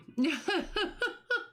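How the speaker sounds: a quick high burst of laughter; short high laugh. Real, not acted. She laughs at herself for yawning mid-call, a short high laugh. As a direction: laugh at yourself, lightly.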